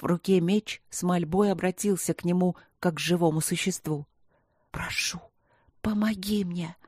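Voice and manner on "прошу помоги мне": barely audible pleading whisper. A narrator's reading. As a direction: breathe the plea almost silently. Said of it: "прошептал он едва слышно". (едва слышный шёпот)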